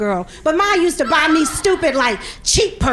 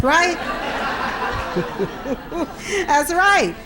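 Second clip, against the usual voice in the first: normal talking voice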